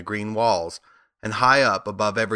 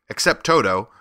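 usual speaking voice

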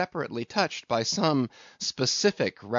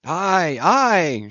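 loud, high crew response; hearty raised-voice aye; shouted; 7 semitones higher, 8 dB louder than usual. A narrator's reading. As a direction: shout assent like eager sailors. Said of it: "shouted the harpooneers and the seamen".

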